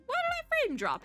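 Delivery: ordinary talk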